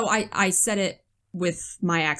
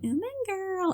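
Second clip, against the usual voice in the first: very high, softer put-on squeal; mock girly greeting; 13 semitones higher, 5 dB quieter, slower than usual. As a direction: mockingly coo it in a high voice. Mock address she says she doesn't do.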